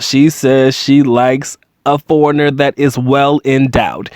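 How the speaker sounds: usual speaking voice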